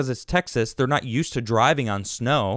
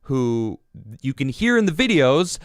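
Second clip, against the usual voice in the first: normal talking voice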